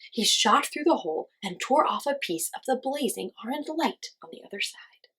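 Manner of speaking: ordinary delivery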